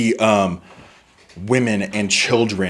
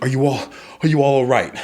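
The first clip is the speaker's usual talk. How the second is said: concerned Simone; 4 semitones higher than usual. Acted. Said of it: "She goes".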